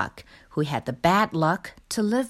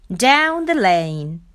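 usual speaking voice

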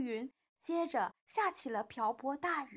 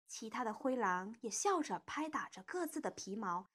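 plain reading voice

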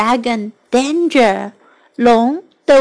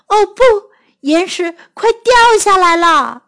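alarmed exclamation; 7 semitones higher, 4 dB louder than usual. Acted. Rock starts to fall.

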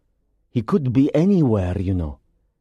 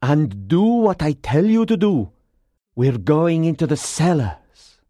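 firm, higher commanding voice; ordering; 7 semitones higher than usual. A narrator's reading. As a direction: lay down the orders, insistently. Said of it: "the Persian ordered".

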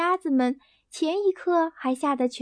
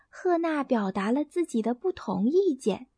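ordinary delivery, nothing marked

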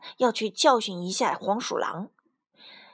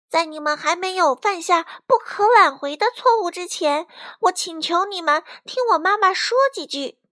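put-on high, raised young voice pleading; brave little pleader; 8 semitones higher, 6 dB louder than usual. A narrator's reading. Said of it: "小卡门鼓起勇气". (鼓起勇气高声恳求)

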